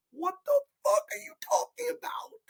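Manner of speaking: very high, strained disbelief; incredulous high-pitched squeal of a question; baffled outburst. Acted. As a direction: squeeze it out way up high, without shouting.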